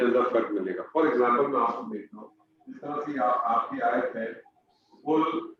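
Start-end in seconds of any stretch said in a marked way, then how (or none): 5.0-5.5 verse recited slowly, drawn out; slow recitation. Shown as a demonstration. Teacher reciting the verse to the class. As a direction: intone the verse with long pauses.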